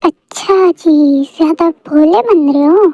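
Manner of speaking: ordinary talk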